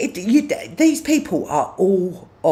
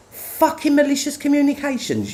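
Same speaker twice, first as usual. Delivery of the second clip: exasperated outburst; 12 semitones higher than usual. Real, not acted. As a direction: pitch jumps way up on the repeat. Repeats phrase in exasperation.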